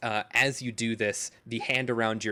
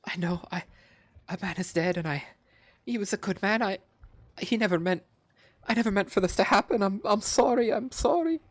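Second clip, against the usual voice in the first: high, rising, louder sobbing apology; weeping; 11 semitones higher, 4 dB louder, faster than usual. Acted. Captive weeping to Rune over the dead man. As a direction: plead through tears.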